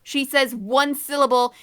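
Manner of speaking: ordinary talk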